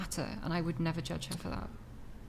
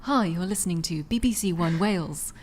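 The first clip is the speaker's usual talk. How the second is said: crisp, formal radio presenter, louder; BBC announcer voice; 5 dB louder than usual. Shown as a demonstration. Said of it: "can we hear something?".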